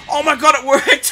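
high, loud surprised cheer; excited shout. Real, not acted. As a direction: burst out in disbelief. Surprise win.

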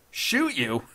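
high, loud mocking echo; laughing scorn. A narrator's reading. Intruder laughing off the judge's plea, derisive. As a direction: throw the plea back derisively. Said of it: "laughed Dodd".

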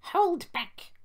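high-pitched, loud stage whisper; squeaky plotting coach; conspiratorial. A narrator's reading. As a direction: pass on a secret order in a squeaky voice. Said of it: "whispered Angus to Ruth".

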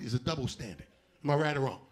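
ordinary talk

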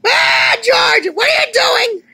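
high-pitched, loud alarmed shout; shrieked scolding; angry yelling. Acted. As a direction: scream at George in alarm. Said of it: "I'm sorry I yell at you".

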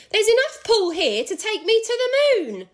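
put-on high, loud old woman's cry; Missus Twit crying out. A narrator's reading. Missus Twit calling down to her husband, high and loud. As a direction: shout it up high like a frantic hag. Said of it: "Missus Twit cried out".

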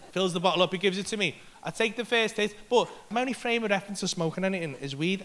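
normal talking voice

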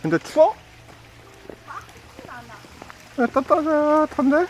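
very high playful voice; playful squeal. Real, not acted.

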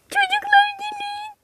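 very high calling out; excited call. Acted. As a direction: call the kids over excitedly. Çocukları çağırıyor. (heyecanla seslenme)

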